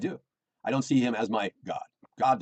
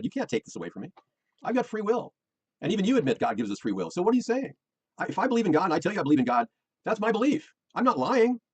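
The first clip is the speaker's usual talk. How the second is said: retold pushback, pitched higher and rising; heated retort; 7 semitones higher than usual. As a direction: fire it back at her, voice climbing. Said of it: "I kinda went right back at her".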